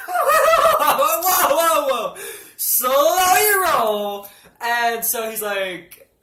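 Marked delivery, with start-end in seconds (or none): 0.1-4.3 high, loud, very slow whoa; loud protest. Retold or imitated. Stopping the doctor, loud and very slow. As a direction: hold him off, drawn out.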